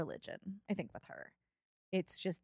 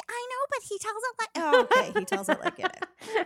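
much higher mocking voice of mom; imitating mom; 12 semitones higher, 3 dB louder than usual. Daughter imitating her mom defending Trump, high mock voice. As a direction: play mom defending him, pitched up. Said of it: "My mom was like".

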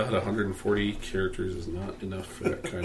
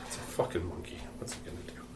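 very soft, voiceless lion grumble; hushed, dismissive aside; grumbling aside; 6 semitones lower, 14 dB quieter, faster than usual. Acted. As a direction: mutter it under your breath. Joke: lion grumbling to himself.

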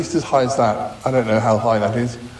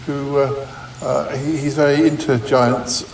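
ordinary delivery

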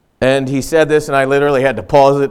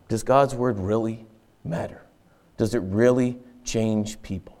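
soft, lowered voice of doubt; quiet doubting; 3 semitones lower, 9 dB quieter than usual. Acted. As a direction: ask it softly, as if questioning yourself. Voicing a leader's inner doubts.

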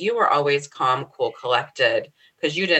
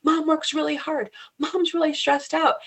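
protective daughter. Retold or imitated. Teen daughter telling her brothers to stop arguing, high protective voice. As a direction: step in for your mother like a fierce little daughter. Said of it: "she'd be like".

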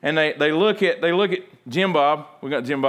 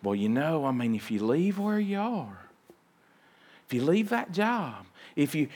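hushed, fretful warning; a softer naysayer talking someone out of a new job; worried naysayer; 7 dB quieter than usual. Retold or imitated. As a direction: murmur the doubts like an anxious friend. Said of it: "And they're going".

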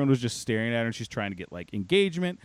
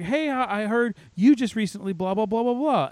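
high-pitched mock bubbly chatter; bubbly newcomer; 8 semitones higher than usual. Newcomer calls out staff by name, mock bubbly. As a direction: imitate an over-friendly woman, pitched up. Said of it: "way too bubbly".

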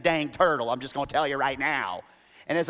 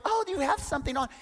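quick high question; mock curious wife; 11 semitones higher, faster than usual. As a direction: ask lightly, high-pitched. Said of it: "And she goes".

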